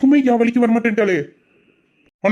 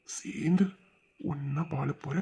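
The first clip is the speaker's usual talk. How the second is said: whisper; 6 semitones lower, 14 dB quieter, slower than usual. Acted. Lovers' whispered promise.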